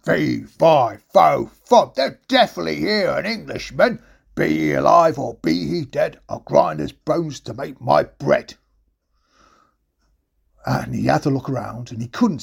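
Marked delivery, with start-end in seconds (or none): 0.1-8.5 booming, deliberate giant voice; menacing giant. Acted. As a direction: chant it like a lumbering giant. The giant's rhyme as he enters.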